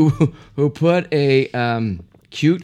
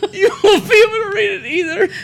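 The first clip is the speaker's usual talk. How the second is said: laughing; faster than usual. Real, not acted. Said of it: "she's reading".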